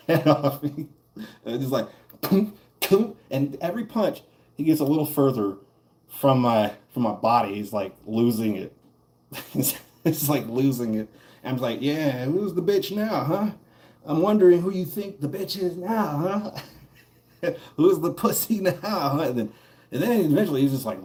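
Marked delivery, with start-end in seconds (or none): 2.2-3.1 "Boom, boom" high, punchy mock impact noises; punch sounds. Acting out his punches on the bully with playful sound effects. 11.8-19.2 slow, high, gloating jeer; mocking taunt. Taunting the bully he is punching, slow and gloating.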